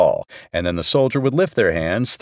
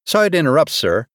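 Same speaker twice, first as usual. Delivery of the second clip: higher, hurried polite interruption; quickly; 6 semitones higher, faster than usual. A narrator's reading. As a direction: cut in quickly but respectfully. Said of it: "Murphy said quickly".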